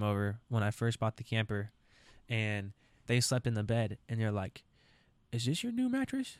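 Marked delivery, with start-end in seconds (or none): 5.3-6.4 much higher, surprised question; quoting Nana and Papa in a much higher voice; high grandparent question. Retold or imitated. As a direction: squeak it up like a fussy grandparent. Grandparents quoted asking.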